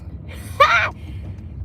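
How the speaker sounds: breathless high-pitched laugh; airy squealing giggle. Real, not acted. After greeting niece.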